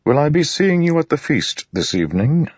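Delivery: polite but guarded lord; wary nobleman. Acted. Narrator voices Royan.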